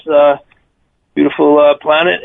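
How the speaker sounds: ordinary delivery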